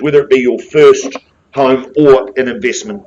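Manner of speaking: normal talking voice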